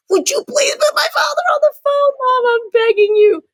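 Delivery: high, loud, laughing desperate plea; begging daughter. Retold or imitated. Daughter begging her mother to fetch father, high laughing plea. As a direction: beg her mother half laughing, half crying.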